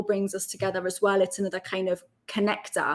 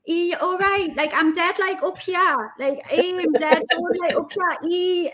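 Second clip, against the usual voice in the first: imitating her younger self, much higher, broad Geordie; young high-pitched self; 9 semitones higher than usual. Mocking her nineteen-year-old radio voice, high and broad. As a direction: squeak it out like a teenage Geordie on the radio. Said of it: "My voice seems very high-pitched as well".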